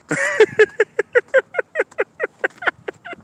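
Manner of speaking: high cackling laugh; gloating laugh. Real, not acted. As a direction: cackle in triumph. Gloating over viewers.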